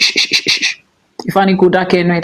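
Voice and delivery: normal talking voice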